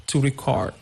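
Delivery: record said as re-CORD; verb stress. Shown as a demonstration. Teacher introducing the verb, second-syllable stress. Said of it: "stress is on the second syllable".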